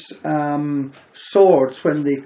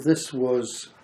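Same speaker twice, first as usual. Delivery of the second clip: ordinary talk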